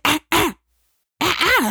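high, rising mock ah ah; high mimicked ah ah; high warning sounds. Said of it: "I said".

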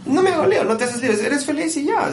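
high, carefree mimicry of a lazy musician; high breezy imitation of a relaxed player; carefree mock voice. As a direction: toss it off lightly, way up high.

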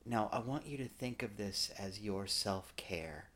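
soft, slow preacher imitation; a touch of a soothing pastor. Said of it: "the preacher will get up and go".

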